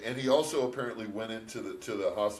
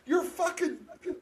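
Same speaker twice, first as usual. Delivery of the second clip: high-pitched mock outrage; worked-up, comic scolding; incredulous rant; 10 semitones higher, 6 dB louder than usual. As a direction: argue back at the movie hero in exasperated disbelief. Mock-arguing back at Rambo, incredulous rant.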